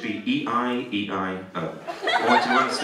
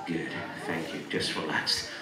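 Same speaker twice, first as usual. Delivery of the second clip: low, hushed reassurance; hypnotist's soothing voice; 8 semitones lower, 8 dB quieter than usual. Real, not acted. Hypnotist praising and relaxing the volunteer, hushed. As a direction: settle them with a soft, low voice.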